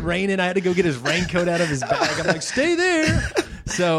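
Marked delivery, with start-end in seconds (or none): 2.4-3.2 very high, comic stop-him cry; mock panicked order. Dad keeps son away from packed bag, mock panic. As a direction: blurt it high as a flustered dad.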